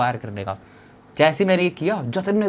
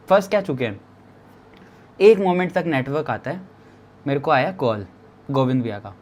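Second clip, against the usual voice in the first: ordinary talk, nothing marked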